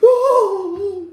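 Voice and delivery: high, loud laughing oh; hammed-up surprise; mock startled oh. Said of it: "He was like".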